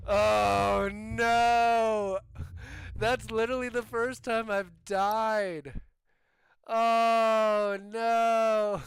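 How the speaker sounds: loud, high cry of dismay; dismayed shout. Real, not acted. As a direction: yell it out in shocked disbelief. Dies in the tornado.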